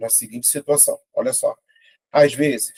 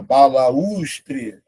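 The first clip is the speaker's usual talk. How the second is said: BALAUSTRE drawn out as ba-la-us-tre, U on its own; syllable by syllable. Shown as a demonstration. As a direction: stretch each syllable of BALAUSTRE. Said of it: "Vou separar".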